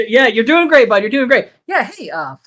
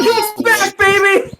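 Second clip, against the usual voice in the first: higher, gleeful cheer; excited; 7 semitones higher than usual. Retold or imitated. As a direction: celebrate like a win. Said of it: "I'm like".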